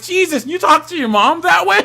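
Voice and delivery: high, loud, laughing disbelief; hammed-up incredulous outcry; mock shock. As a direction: exclaim it appalled and amused. Retelling his shock at friend.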